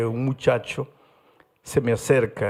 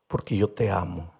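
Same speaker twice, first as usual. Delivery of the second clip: soft, lower, sincere; tender; 6 semitones lower, 4 dB quieter than usual. Retold or imitated. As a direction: say it gently, from the heart. Pastor tells boy he loves him.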